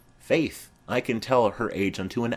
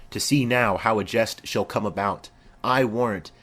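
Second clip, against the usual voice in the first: usual speaking voice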